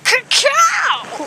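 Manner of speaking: high bird squawk; giant bird call. Acted. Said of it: "I'm just giant bird".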